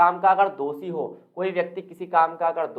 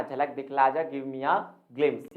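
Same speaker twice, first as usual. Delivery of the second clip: "give me a glimpse" drawn out very slowly; slow model sentence; 3 semitones lower, much slower than usual. Shown as a demonstration. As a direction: spell the phrase out slowly for learners. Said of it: "है ना". ("give me a glimpse" बहुत धीरे बोलें)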